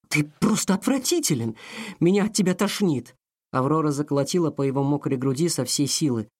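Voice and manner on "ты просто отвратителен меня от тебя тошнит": disgusted outburst. A narrator's reading. Aurora disgusted, furious.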